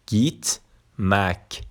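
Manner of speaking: slow "گیت مک" as geet, pause, mek; dotted i as "ee". Shown as a demonstration. Teacher demonstrating the dotted i, slow clear ee in گیت مک. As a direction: draw out the ee in گیت, then add مک.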